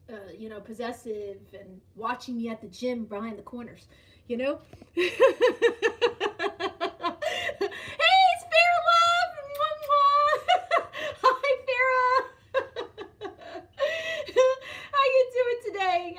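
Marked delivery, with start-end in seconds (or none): 5.0-5.1 high, loud squealed hello with laughter; over-the-moon, laughing welcome; gleeful greeting. Real, not acted. Greets viewer who just joined. 5.2-8.3 long, loud, high laughing fit; real, uncontained cackling laugh; delighted laugh. Real, not acted. Laughs as viewer joins. 8.3-16.2 high, loud squealed hello with laughter; over-the-moon, laughing welcome; gleeful greeting. Real, not acted. Greets viewer who just joined.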